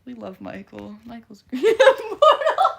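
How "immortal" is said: high, loud laughing shout of a name; laughing shriek. Real, not acted. Reacting to Immortal's lobster spam with a laughing shriek.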